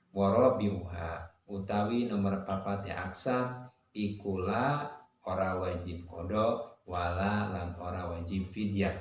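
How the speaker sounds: ordinary talk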